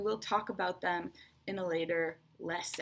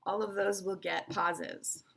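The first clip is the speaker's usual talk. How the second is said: ordinary delivery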